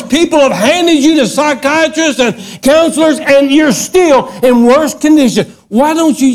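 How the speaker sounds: high-pitched, insistent sermon delivery; forceful preaching. Real, not acted. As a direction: drive it home like a revival preacher.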